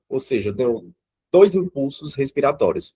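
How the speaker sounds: ordinary delivery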